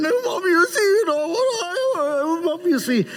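high-pitched tearful whine, easing down; mock crying. Grown person crying to Mom Lynn, mock sobbing. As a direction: blubber an excuse to Mom, hammed up. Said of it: "I had enough of this crying".

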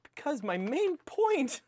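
high-pitched, strained insistence; exasperated insistence. Real, not acted. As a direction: push the point through in exasperation. Struggles to make interrupted point.

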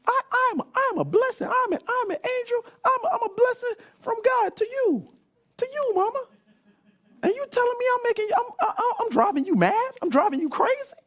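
put-on high pleading kid voice; a wounded child protesting to mama; indignant child. Son protesting to his mother, high and hurt.

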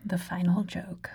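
whispered aside. Acted. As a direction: breathe it out as a secret joke. Quip about final episode.

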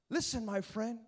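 ordinary talk

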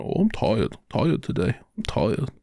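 sleepy, complaining coworker voice; imitating a tired coworker whining about coming in; Dumpy impression. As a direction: play a groggy slacker moaning he's tired. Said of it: "When you talk as Dumpy".

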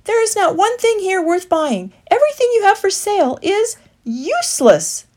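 high, loud indignant retort; sharp retort. A narrator's reading. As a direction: fire it straight back. Woodley accusing.

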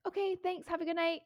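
high, bright fake politeness to end the chat; chirpy thanks to get away fast; fake polite brush-off.

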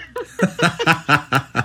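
long run of laughter; hearty laugh. Real, not acted. Wrestler finishing his prank story, hearty laugh. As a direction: crack up after your own punchline.

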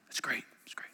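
hushed, awed whisper; impressed whisper. Retold or imitated. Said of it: "You'd be like".

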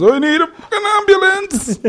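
high, drawn-out mock wail; hammed-up plea for emergency help; panicked caller. Comedian acting out a mishap for co-hosts, mock panicked wail.

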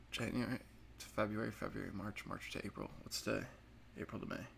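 half-whispered counting aside; counting under breath. Real, not acted. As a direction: count the months quietly to yourself. Counting months under his breath.

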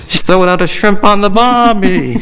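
put-on high, hammy outback accent; Australian accent. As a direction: overplay the Aussie accent. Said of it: "That's Australian".

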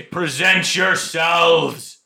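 booming slow barked command; drill sergeant. Pretend. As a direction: bark orders at recruits. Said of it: "like a drill sergeant".

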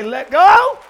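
preacher's call to let go; loud call and response. Shown as a demonstration. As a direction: get them clapping and shouting. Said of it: "Clap your hands and say".

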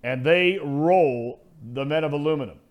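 big booming sportscaster call; drawn out like a broadcast intro; announcer voice. Acted. Announcer-style game call.

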